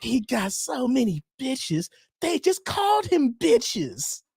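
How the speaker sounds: high, slow comic quoting voice; mock cartoon quote. Fan quoting a Boondocks line, high drawn-out mock voice.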